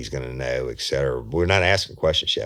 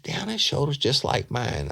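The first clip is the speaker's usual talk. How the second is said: high put-on voice; 10 semitones higher than usual.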